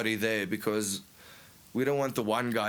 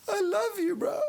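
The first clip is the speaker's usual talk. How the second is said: mock crying friend. Drunk bandmate sobbing to his friend in the car. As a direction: imitate a drunk friend breaking into tears. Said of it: "And he starts crying".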